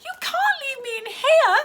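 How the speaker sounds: tearful protest. Acted. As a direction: beg not to be left. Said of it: "protested Stella".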